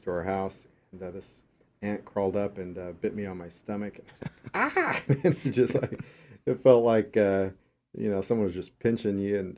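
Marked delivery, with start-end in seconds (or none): 4.5-5.0 high sudden yelp; yelp of pain. Retold or imitated. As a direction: yelp like you just got bitten. Retold ant bite yelp.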